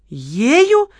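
stunned by the accusation; surprised question. A narrator's reading. Kamila asks Lola back about the waitress, astonished. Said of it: "переспросила Камила удивлённо".

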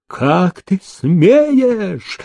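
high, very slow strangled cry; choked with fear. A narrator's reading. Paralytic crying out at the menacing peasant, choked with fear. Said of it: "перехваченным от страха голосом".

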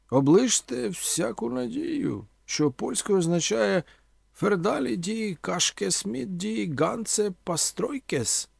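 high-pitched, theatrical oratory; declaiming orator Omela. A narrator's reading. Omela haranguing young listeners with grand questions, declaiming.

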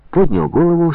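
usual speaking voice

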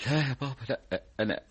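soft, faltering through tears; tearful denial. Acted. Son denying to his father that he cries, tearfully. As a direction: deny it weakly while crying. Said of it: "أنت بتعيط من أول مشكلة".

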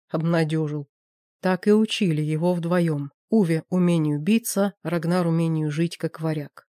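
usual speaking voice

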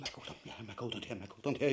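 soft, low murmur of temptation; tempted murmur. Acted. Ubu giving in to his wife's bait, murmured softly. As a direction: murmur to yourself as you give in.

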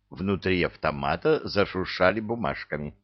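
ordinary delivery, nothing marked

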